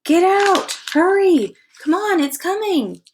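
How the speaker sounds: high, loud panicked screams; terrified screaming at Sid. Acted. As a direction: scream at him to move. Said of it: "They all screamed at Sid".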